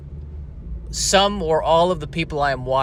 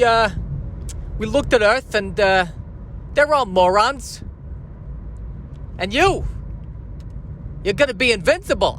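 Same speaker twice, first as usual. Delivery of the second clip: higher-pitched New York Jor-El; casual, chatty New York wiseguy voice; New York accent; 5 semitones higher than usual. Acted. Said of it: "with a New York accent".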